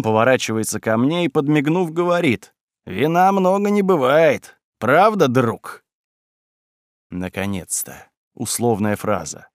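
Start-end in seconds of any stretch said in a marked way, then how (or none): 2.8-5.8 put-on drunk voice. Spy keeps up drunk act.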